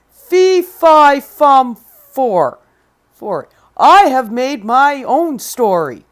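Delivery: loud, slow giant chant; booming giant. Acted. Giant reciting his rhyme at Jack, booming. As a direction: boom out every word. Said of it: "stop talking in uppercase letters".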